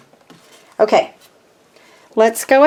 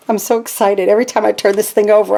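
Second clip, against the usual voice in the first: ordinary delivery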